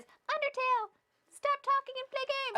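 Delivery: high squeaky chat voice; a chorus of impatient viewers, high and squeaky. Retold or imitated. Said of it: "The sound of the chat is".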